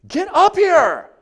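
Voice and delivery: very high, loud, drawn-out command; bratty shout. Retold or imitated. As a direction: order your mother around like a spoiled brat. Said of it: "she just was like".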